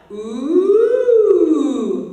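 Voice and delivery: loud rising ooh slide; high vocal slide. Shown as a demonstration. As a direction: siren up into head voice. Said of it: "There's not a lot of flip".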